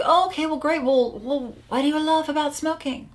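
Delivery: high, lilting mock-agreeable questioning; coach's reply. Coach humouring a client who loves smoking, high-pitched agreeable voice. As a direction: play along, pitched well up. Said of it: "I was like".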